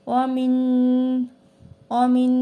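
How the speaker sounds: ওয়া মিন with a nasal, held ন; ghunna on min. Shown as a demonstration. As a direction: hum the ন of মিন through the nose. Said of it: "তাহলে এইভাবে বলবো আমরা". (গুন্নাসহ মিন)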